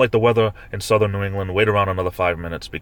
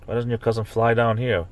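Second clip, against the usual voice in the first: usual speaking voice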